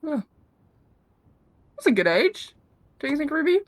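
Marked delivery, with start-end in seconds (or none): none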